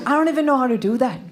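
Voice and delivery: put-on British accent; mock British English. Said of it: "speak with a British accent".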